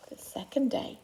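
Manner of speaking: a hushed stage whisper for the heading. A narrator's reading. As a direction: whisper it like a secret to the children. Whispered chapter heading in story.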